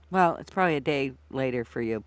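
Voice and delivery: ordinary delivery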